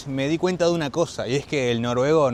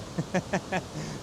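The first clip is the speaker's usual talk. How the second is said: chuckle; 7 dB quieter than usual. Real, not acted. Vlogger showing viewers the tiny road, soft amused chuckle. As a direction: let out a soft little laugh.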